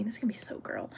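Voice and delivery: barely voiced, soft whisper; whispered aside. Real, not acted. Speaker warning viewers before the ex story, whispered aside. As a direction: whisper it to yourself as a disclaimer.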